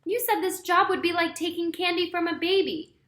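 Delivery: angry complaint at partner. A narrator's reading. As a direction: blame him hotly. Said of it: "Lefty said in an angry way".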